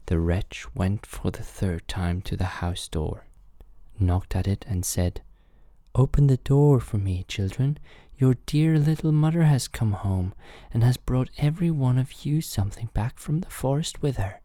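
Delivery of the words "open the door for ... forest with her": high, coaxing fake mother; softened wolf voice. A narrator's reading. Wolf tries the door a third time, high softened voice. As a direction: coax as a wolf posing as mother. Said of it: "made his voice soft with it".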